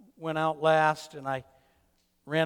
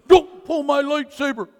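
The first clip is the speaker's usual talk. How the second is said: loud, high imitation of an upset child; mock a small boy's protesting shout; high, indignant kid outburst, acted; upset child's yell; 9 semitones higher, 12 dB louder than usual. Upset grandson yells during sword fight.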